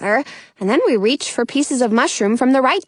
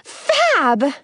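higher, delighted exclamation; excited cry; 4 semitones higher than usual. A narrator's reading. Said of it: "Robin cries".

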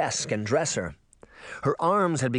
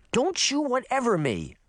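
scolding; 12 semitones higher than usual. A narrator's reading. Daphne scolds sister.

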